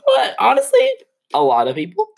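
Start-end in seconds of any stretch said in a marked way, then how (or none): 0.0-0.9 very high sassy squeak; sassy falsetto. Acted. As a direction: squeak it out with sass.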